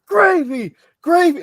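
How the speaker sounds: high, loud drawn-out yell; crowd shout. Shown as a demonstration. Wrestling fan cheers the debut match, shouting. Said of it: "just so we can shout".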